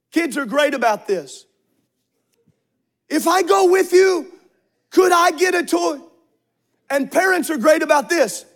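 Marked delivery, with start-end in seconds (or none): 3.1-6.0 put-on wheedling child. Voicing a child asking.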